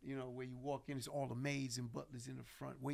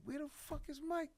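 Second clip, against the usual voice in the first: frustrated; 12 semitones higher than usual. Retold or imitated. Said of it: "I'm like".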